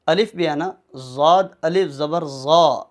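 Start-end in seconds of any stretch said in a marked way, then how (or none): 1.2-2.8 slow spelling-out with "زا" held long; measured chant of letters, long aa; stretched spelling drill. Shown as a demonstration. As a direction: draw out "زا" at the end. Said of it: "کھینچ کر پڑھتے ہیں".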